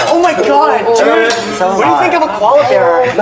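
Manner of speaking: hammed-up American dude; American accent. Shown as a demonstration. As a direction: gush in a put-on American accent.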